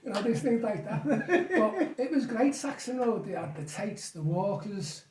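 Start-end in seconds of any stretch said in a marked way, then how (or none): 1.1-3.2 slow, laughing and higher; fond laughing recollection. Real, not acted. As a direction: savour it through a laugh.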